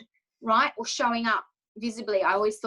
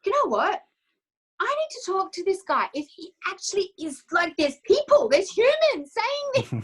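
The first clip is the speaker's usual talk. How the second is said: excited realisation; 9 semitones higher, 3 dB louder than usual. Retold or imitated.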